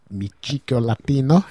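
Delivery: mock Spanish flourish on the words; Spanish accent. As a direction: play at a Spanish accent with gusto. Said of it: "if you like my Spanish accent".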